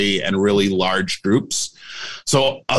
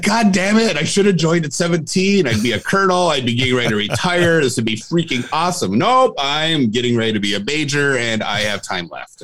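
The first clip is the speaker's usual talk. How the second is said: exasperated inner rant; 5 semitones higher than usual. Retold or imitated. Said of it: "I'm like".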